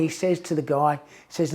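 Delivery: normal talking voice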